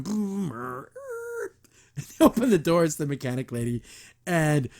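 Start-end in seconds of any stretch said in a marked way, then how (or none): 0.0-1.5 put-on high squeaky engine noises like a kid at the wheel; kid's car noises. Recapping a cartoon, mimicking a kid pretending to drive: engine noises. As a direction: play at driving, making the engine sound. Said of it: "like a little kid behind the wheel going".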